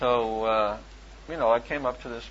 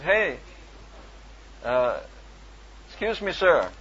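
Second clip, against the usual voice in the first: calling out; 3 semitones higher, slower than usual. Retold or imitated. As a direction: get his attention from across the garage. Calling to man under car.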